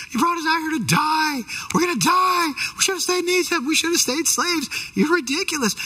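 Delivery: high-pitched mock panicked moan; complaining and moaning. Frightened Israelites turning on Moses at the sea, complaining and moaning. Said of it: "just complaining and moaning".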